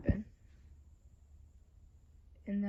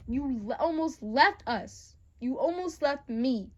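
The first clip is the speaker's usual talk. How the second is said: hurt anger; 3 semitones higher, 6 dB louder, slower than usual. Acted. Acted hurt confrontation after suicide attempt.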